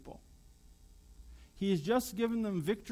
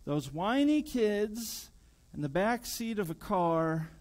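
usual speaking voice

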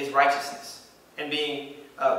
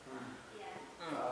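very soft hesitant hum; an unsure murmur under the breath; quiet doubtful hmm; awkward hmm; 4 semitones lower, 24 dB quieter than usual. Retold or imitated. Acting unsure entrance.

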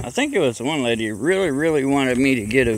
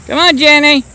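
high, loud shouted call; 10 dB louder than usual. Real, not acted. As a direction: holler across the field. Calling donkey over.

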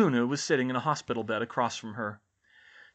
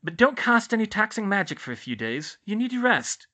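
high, firm matron; Madame Pomfrey's character voice; 10 semitones higher, 6 dB louder than usual. Acted. Nurse warning her patients to rest, high character voice. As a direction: instruct in a raised, louder nurse's voice.

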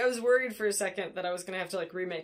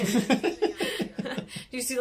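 slow words through laughter, higher; laughing; 6 semitones higher, 6 dB louder, much slower than usual. Real, not acted. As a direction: ask while still laughing. Still laughing while asking.

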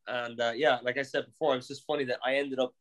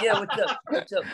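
higher, laughing mock [name] greeting; hammed-up casual "what's up", pitched up; [name] leaning in, mock greeting higher; 7 semitones higher, 4 dB louder than usual.

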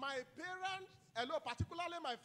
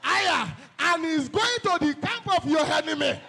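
rising chant of hey into loud, high decrees; fiery prayer shout; 5 semitones higher, 10 dB louder than usual. Acted. Preacher leading a warfare prayer, a building roar. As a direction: build from a call into a roaring declaration.

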